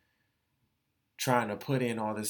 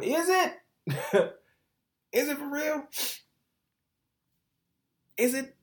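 incredulous challenge; 14 semitones higher, 5 dB louder than usual. Real, not acted. Mocking the "it's the job" advice.

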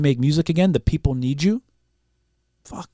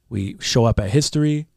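ordinary talk

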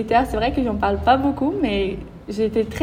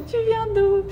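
curious stranger; 11 semitones higher than usual. Retold or imitated. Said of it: "il y a toujours la question".

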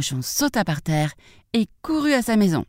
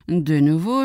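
ordinary delivery

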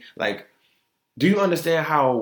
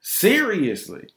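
Seriously said slowly and higher, falling; drawn-out emphasis; 6 semitones higher, slower than usual. Real, not acted. To her listeners, stressing she means it, slow. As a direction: stretch out Seriously with weight. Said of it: "repeats seriously".

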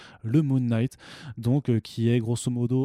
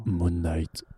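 lower, graver voice for the name; deep voice; 5 semitones lower than usual. Pretend. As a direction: drop the voice low and grave. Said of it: "je vais peut-être déjà prendre une voix plus grave".

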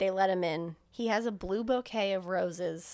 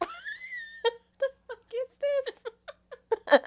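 much higher, dragged-out, half-crying disbelief; very slow, high, squealing shock; incredulous outburst; much slower than usual. Real, not acted. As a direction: stretch it out in stunned disbelief. Reacting to absurd movie scene.

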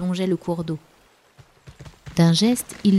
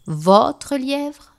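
high, incredulous bear exclamation; ours qui s'exclame; 7 semitones higher, 4 dB louder than usual. A narrator's reading. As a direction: exclaim it as an affronted question. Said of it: "s'exclama l'ours". (exclamation étonnée, nettement plus aiguë)

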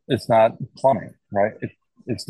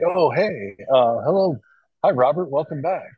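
lightly voiced doctor, higher pitch; doctor greeting patient; 5 semitones higher than usual. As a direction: imitate a doctor's lilting hello to a patient. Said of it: "I heard my brother correct the doctor".

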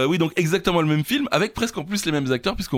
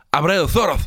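louder, higher Spanish accent with a lisped s; 4 semitones higher, 6 dB louder than usual. Shown as a demonstration. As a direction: say it the Spanish way, proudly. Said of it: "avec l'accent espagnol".

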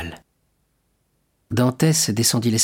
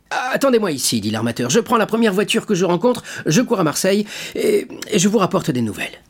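higher, hurried voice; eager shipowner; 10 semitones higher than usual. Acted. L'armateur promettant des nouvelles, pressé. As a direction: rush to reassure them.

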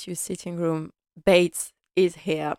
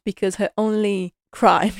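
ordinary delivery

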